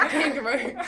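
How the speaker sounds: Australian accent. Shown as a demonstration. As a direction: try an Australian accent through giggles. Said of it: "a good Australian accent".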